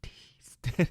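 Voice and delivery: tiny hissed whisper syllables; imitated whisper sounds. Hunter imitating what the buck heard from afar, whispered. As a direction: mimic the sibilants of an overheard whisper. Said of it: "Like, he heard the tee".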